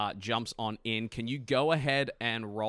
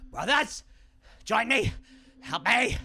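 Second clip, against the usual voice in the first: much higher, shouting to his brothers; desperate call for help; 11 dB louder than usual. Acted. Bloodied demon shouting to his brothers for help. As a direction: yell for reinforcements across the battlefield. Demon calls allies in Abyssal.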